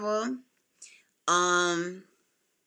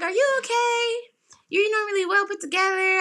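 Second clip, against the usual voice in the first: high-pitched imitation of a concerned girl; concerned receptionist; 10 semitones higher than usual. Girl at doctor's office checks on her, high concerned imitation.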